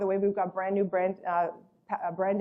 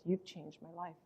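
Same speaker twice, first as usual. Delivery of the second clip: softly retold emotional thanks; hushed, as if choked up; retold tearfulness; 2 semitones lower, 11 dB quieter than usual. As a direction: imitate a tearful thank-you, quietly. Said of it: "she starts bawling her eyes out".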